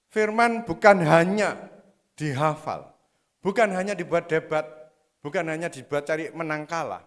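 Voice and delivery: loud, slow sermon emphasis on bukan; preaching at full force. Acted. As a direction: preach it hard, hammering each bukan. Khotbah penuh semangat. (khotbah berapi-api dengan tekanan keras)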